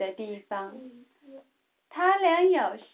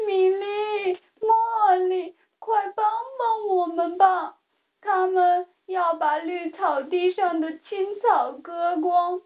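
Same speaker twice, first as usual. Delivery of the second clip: higher pleading voice, mildly sad; sad plea; 6 semitones higher than usual. A narrator's reading. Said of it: "田鼠们伤心地说道". (略带伤心的恳求)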